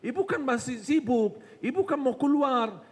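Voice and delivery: high, insistent tempter's reminders; bisikan syaitan. Acted. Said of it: "langsung dibisikin terus dengan kesibukan". (setan mengingatkan dengan nada tinggi)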